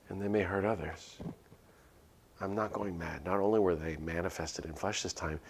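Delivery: usual speaking voice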